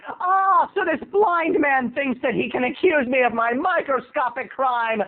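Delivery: high, grandiose scoffing; hammed-up righteous outrage; mock indignation. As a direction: scoff like a pompous little lawyer. Retold kid mocking his accuser.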